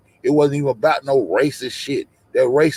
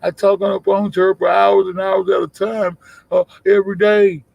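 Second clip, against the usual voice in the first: imitating the defender; 6 semitones higher than usual. Streamer quotes someone defending a friend, imitating them. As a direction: throw their defence back, pitched up. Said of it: "came out this motherfucker and said".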